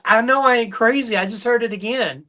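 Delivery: high, puzzled woman's voice; confused lady. Retold or imitated. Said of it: "She goes".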